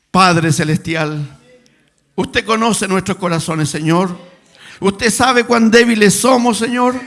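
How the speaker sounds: loud, slow, pleading prayer; earnest prayer. Acted. Pastor leading the congregation in prayer, loud and pleading. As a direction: plead with the Father before the church.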